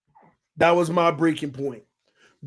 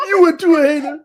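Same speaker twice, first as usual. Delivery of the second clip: higher, louder playful telling-off; higher mock outrage at the baby; mock scolding; 10 semitones higher, 5 dB louder than usual. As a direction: pretend outrage at the giggling baby. Teasing the baby as hater.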